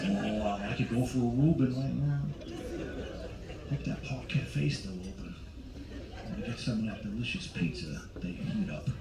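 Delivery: put-on hungry old man muttering. Voicing hungry old guys.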